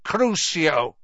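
loud, cold curse. A narrator's reading. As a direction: cast it coldly and sharply. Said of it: "said the cold voice".

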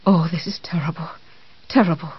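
faint, fluttery whisper. Acted. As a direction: breathe it out weakly. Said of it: "in a faint, fluttery voice".

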